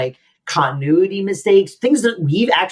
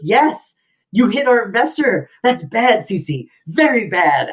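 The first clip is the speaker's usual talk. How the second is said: higher, mock scolding a naughty dog; scolding [name]; 6 semitones higher than usual. [name] mock scolding Cece like a dog, higher. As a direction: chide her like a bad puppy. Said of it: "he goes".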